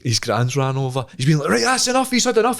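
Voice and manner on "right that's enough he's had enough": much higher scolding gran; mock gran. The gran breaking it up, much higher voice. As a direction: stop the fight like a gran.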